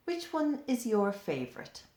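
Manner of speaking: plain reading voice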